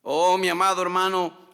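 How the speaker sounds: usual speaking voice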